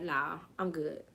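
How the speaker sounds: low, quiet, slow brush-off; dismissive refusal. Retold or imitated. Host quoting her distrustful self, low quiet refusal. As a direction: decline softly under your voice. Quotes herself declining opportunities.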